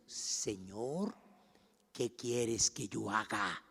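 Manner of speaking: soft, low, slow prayer; submissive question. Acted. Preacher voices Saulo answering the Lord, hushed submissive question. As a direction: ask it quietly and slowly, in submission. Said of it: "Él le dijo".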